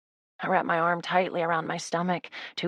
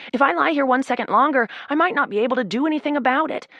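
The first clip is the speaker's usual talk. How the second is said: panicked rush; 7 semitones higher, 4 dB louder than usual. Acted. Panic continues.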